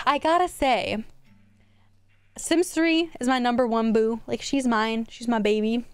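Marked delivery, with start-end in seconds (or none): none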